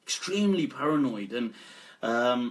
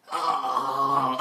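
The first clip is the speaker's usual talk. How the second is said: croaky groan; 2 semitones lower than usual. Pretend. Faking illness to skip work, croaky groan. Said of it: "you get that croaky voice".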